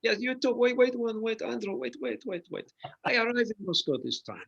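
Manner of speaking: hammed-up Russian accent, high and loud. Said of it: "I love the way you do your Russian accent".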